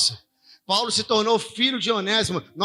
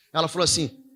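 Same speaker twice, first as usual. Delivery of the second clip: ordinary talk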